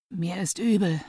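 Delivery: weak, nauseous whisper. A narrator's reading. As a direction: whisper it, about to gag. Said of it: "flüsterte er und würgte".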